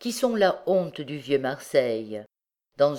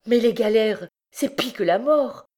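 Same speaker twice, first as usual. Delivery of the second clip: high, louder cry of dismay; horrified protest; 7 semitones higher, 4 dB louder than usual. A narrator's reading. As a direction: protest in horror, pitch held high. Mother horrified at forced labour. (protestation horrifiée)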